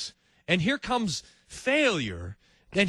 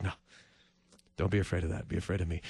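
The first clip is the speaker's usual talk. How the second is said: softer, lower reassuring God; gentle hush; 10 semitones lower, 6 dB quieter, faster than usual. Acted. The Lord shaking his head at pain, softly. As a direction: calmly wave away the fear. The Lord answers about loneliness.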